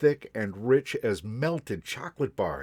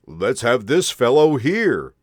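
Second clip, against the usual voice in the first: loud, hearty Santa voice; jolly Santa; 3 semitones higher, 11 dB louder than usual. A narrator's reading. Santa picking the boy from the crowd, hearty and loud. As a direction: boom it out like Santa.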